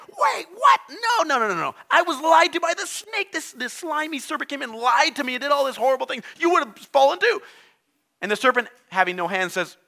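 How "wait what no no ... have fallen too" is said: shrill shouted protest, much higher; outraged Eve. Acted. Preacher acting Eve blaming the serpent. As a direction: shout that you were tricked. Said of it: "She's like".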